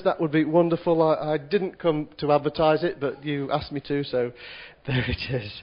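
ordinary talk, nothing marked

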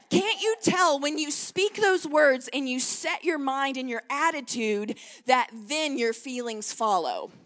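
ordinary talk, nothing marked

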